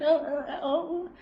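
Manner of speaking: ordinary talk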